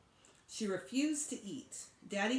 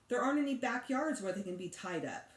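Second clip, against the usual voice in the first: ordinary delivery, nothing marked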